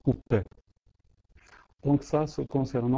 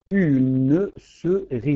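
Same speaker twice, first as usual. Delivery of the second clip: slow, each syllable set apart and louder; counting syllables slowly; 5 dB louder, much slower than usual. Shown as a demonstration. Teacher to class, spacing "Une souris" syllable by syllable. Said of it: "Nous avons quatre, euh, quatre syllabes".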